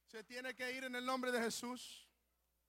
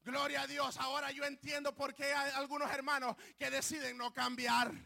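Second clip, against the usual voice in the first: "Gloria a Dios" called out louder; loud praise; 2 semitones higher, 10 dB louder than usual. Retold or imitated. Preacher retelling his prayer, louder praise. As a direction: burst out in praise. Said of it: "Levanté las manos y dije".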